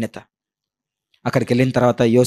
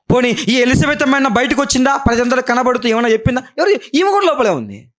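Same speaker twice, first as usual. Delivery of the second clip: raised, high preaching voice; 11 semitones higher than usual. Acted. Preacher questioning the congregation, raised and high.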